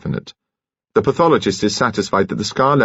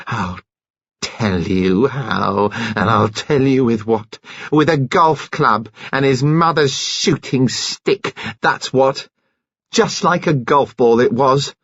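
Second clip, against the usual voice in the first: slow raging disclosure, climbing higher; beside herself; 5 semitones higher, slower than usual. A narrator's reading. Enraged woman tells how he was killed, seething. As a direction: spell it out slowly, building to a high finish. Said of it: "She was beside herself with rage".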